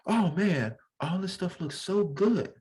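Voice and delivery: lightly voiced tourist. Connecticut guy gushing at the New York deli. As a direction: gush over the food like a newcomer. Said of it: "He walks in and he goes".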